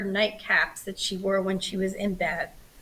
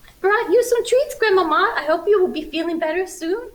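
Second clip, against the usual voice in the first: put-on high, bright little girl's voice; little girl voice, higher and louder; sweet little girl; 10 semitones higher, 4 dB louder than usual. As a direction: sing it up like a small child. Red greets sick grandmother.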